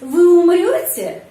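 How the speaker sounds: умрёте high and loud, dropping at the very end; question intonation. Shown as a demonstration. Teacher demonstrating a question sentence, strong stress on умрёте. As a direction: ask slowly, punching up умрёте. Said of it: "Kalimat tanya".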